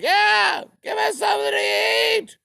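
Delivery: very high, loud, desperate mock yell; hungry peasants' shrill cry. Ukrainians begging the Germans for food, high and shrill. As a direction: wail it like starving villagers begging. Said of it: "They were like".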